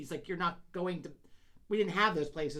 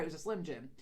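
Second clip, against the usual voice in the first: ordinary talk, nothing marked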